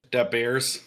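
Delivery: put-on Chicago accent with "Da" said as a d sound for "the". Host joking about the Indiana accent, in a Chicago accent. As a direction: say "Da Bears" like a Chicago sports fan. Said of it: "that's more Chicago".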